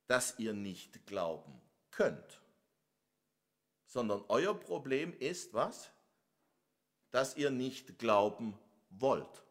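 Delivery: ordinary talk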